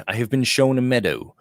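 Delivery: ordinary delivery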